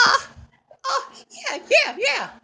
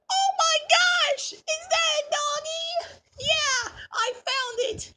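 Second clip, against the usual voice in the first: usual speaking voice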